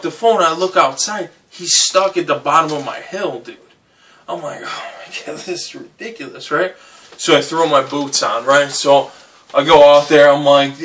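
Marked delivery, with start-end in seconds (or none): 4.6-6.5 whispered disbelief. Retold or imitated. Seeing him stuck, laughing.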